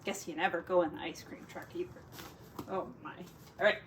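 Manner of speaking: usual speaking voice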